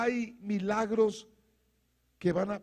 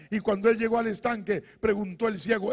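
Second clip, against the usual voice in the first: normal talking voice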